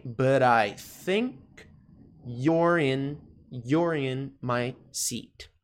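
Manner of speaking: slow, piece-by-piece "but I think you're in my seat"; careful teacher's model with pauses between chunks; slow pronunciation model. Shown as a demonstration. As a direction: draw out each chunk for learners to copy. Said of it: "Coisinhas importantes com relação à pronúncia".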